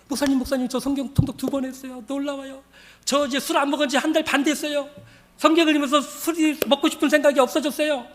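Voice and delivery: high, eager acted announcement; imitated happy, amazed testimony; excited report. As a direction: share proud news eagerly. Woman excitedly reports second reading.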